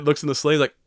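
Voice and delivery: ordinary talk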